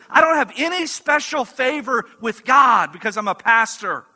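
fervent sermon delivery; impassioned preaching. Acted. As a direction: press the good news on them with conviction.

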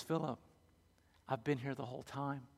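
soft, close, intimate words; leaning in softly. Acted. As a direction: lean in and say it softly. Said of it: "Jesus leans in a little bit and goes".

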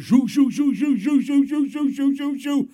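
helicopter whoosh. Retold or imitated. Preacher acting out the rescue helicopter lifting off, playful whoosh sounds. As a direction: imitate the chopper blades whooshing away.

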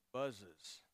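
low buzzing like a bee. Shown as a demonstration. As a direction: buzz it out like a bee. Bee sound imitated.

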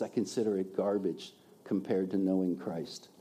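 low, soft retelling of a quote; hushed awe. Retold or imitated. Preacher repeats Paul's verdict on church planting, hushed. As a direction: drop down low and quiet, marvelling. Reflects on Paul's words after exhaling.

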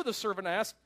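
ordinary delivery

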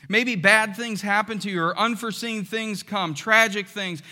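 ordinary delivery

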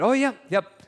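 caught off guard. Retold or imitated. Father caught off guard by question.

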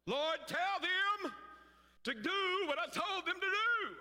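high, slow, complaining plea to the Lord; a frustrated servant whining to God; whining complaint. Retold or imitated. Martha's whining prayer.

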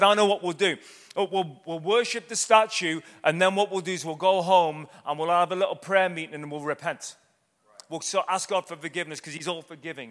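sarcastic put-on of a hollow excuse; mocking. Preacher mocking believers who fake their worship, sarcastic.

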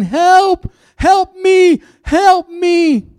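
high-pitched, loud mock cry for help; hammed-up, very high desperate yelling. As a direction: cry out as a man acting trapped with a lion. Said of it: "gonna start crying out for help".

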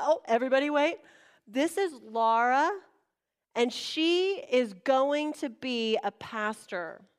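imitated grand introduction; pastor's public announcement. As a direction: announce it slowly to the whole congregation. Pastor announcing her to congregation.

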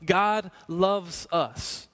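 "God loves us." very slow, "God" weighted; a slow, weighty repeat of "God loves us."; slow emphasis. Shown as a demonstration. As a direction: draw it out, stress on "God". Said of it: "Let that sink in".

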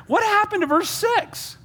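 very high, drawn-out disbelief; mock incredulous. Preacher catching Saul breaking his verse-six promise, mock incredulous. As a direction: squeal it slowly in comic outrage. Preacher mocks Saul's broken oath.

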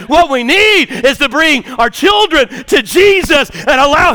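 loud, emphatic pulpit preaching; full-voiced sermon delivery. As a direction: drive the point home to the congregation. Preaching to congregation.

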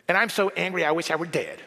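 fast, whiny rant; whiny Jonah. Acted. Preacher voicing Jonah wishing himself dead, fast whine. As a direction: rush the complaint like a spoiled child. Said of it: "He gets whiny".

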